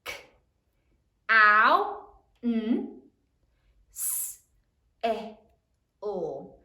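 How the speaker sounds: "K. Ow. N. S. E. O." as isolated speech sounds; sounding it out. Shown as a demonstration. Teacher sounds out counsel for the class, sound by sound. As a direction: give each sound in "K. Ow. N. S. E. O." alone, pausing between. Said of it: "Sound it out".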